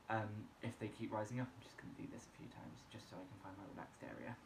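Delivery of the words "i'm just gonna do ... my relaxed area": whispering. Real, not acted. Whispers between breathing reps.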